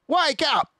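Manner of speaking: very high, loud wake-up shout; shouted warning; shouted alarm. Acted. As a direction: rouse them urgently. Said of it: "he shouted".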